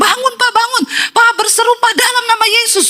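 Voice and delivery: high-pitched frantic pleading, imitated; wife's urgent calling. Wife rousing her possessed husband, high urgent mock cries. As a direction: mimic a wife urging him to wake.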